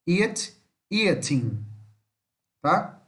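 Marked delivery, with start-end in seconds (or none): 0.9-1.6 final G left off eating; eating with silent G. Shown as a demonstration. Teacher showing students not to say the G, eating with silent G. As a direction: say eating without sounding the G. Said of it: "Não fala o G".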